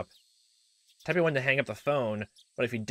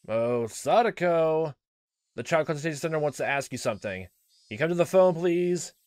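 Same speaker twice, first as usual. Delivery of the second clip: sweet, phony voice; 6 semitones higher, 4 dB louder than usual. Acted. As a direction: coax her with fake sweetness. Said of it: "addressing Sadako in a sweet, phony voice".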